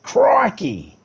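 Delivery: high, loud, astonished Aussie cry; hammed-up Aussie outburst; Australian exclamation. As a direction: yelp it in shock, broad Australian. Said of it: "you were like".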